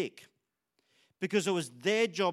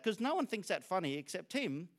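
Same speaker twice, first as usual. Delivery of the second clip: ordinary delivery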